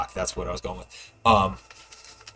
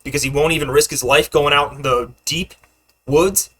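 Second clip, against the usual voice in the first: loud, high heated rant; 4 semitones higher, 8 dB louder than usual. Acted. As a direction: rant it at them, worked up. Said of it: "Guy's like".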